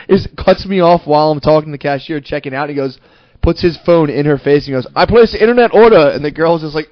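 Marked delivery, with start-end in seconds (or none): 4.9-6.1 high, loud mock demand; imitating pushy customer. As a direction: play the entitled student cutting in.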